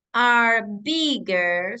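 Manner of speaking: slow, careful English; teacher modelling slowly; slow model phrase. Shown as a demonstration. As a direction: dictate it slowly. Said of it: "puedo decir".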